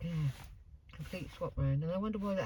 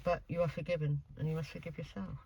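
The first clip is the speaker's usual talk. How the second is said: gentle reassurance; 8 dB quieter than usual. Acted. Medium soothing the spirit.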